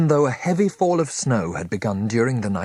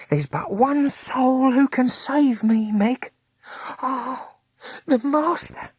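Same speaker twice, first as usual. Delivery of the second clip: much higher, slower, pleading; pleading Smith; slower than usual. A narrator's reading. Smith begs Meg to fetch the master, high pleading.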